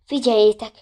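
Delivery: ordinary delivery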